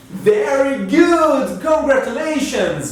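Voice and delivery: cheerful praise. Acted. Teacher praises the class.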